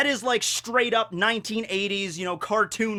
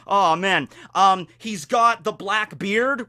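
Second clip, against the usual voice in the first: ordinary talk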